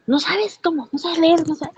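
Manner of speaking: scolding boss. Retold or imitated. Boss scolding her over wrong medicine. (la licenciada regañándola por confundir medicamentos, imitada muy aguda)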